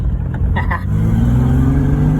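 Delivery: loud happy laugh; gleeful laugh. Real, not acted. Joyful drive.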